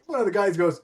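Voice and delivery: ordinary delivery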